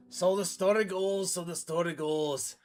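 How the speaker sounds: put-on Scottish old storyteller voice; Scottish accent. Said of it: "really Scottish".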